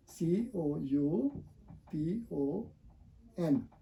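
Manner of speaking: letters spelled out very slowly; slow spelling. Shown as a demonstration. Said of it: "spells the word slowly".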